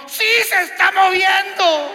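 high, loud cry of alarm; hammed-up terrified shout; panicked yell. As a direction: shout it as the fear grabs you. He panics too.

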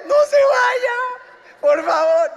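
high crying plea for the boat to stay; desperate crying shout. Retold or imitated. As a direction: wail at the leaving boat. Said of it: "mira el ridículo".

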